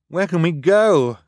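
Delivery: high, loud urgent shout; shouting over noise. A narrator's reading. Boy shouts to his sisters over the roar of the fire, shouting. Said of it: "shouting over the roar of the fire".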